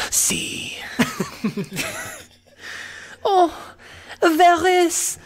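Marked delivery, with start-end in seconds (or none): 3.2-5.2 high mock French voice; French accent. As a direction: slip into a fake French accent. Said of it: "that's French".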